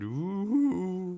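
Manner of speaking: a high, soft ghostly ooh. Pretend. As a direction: moan like a little ghost.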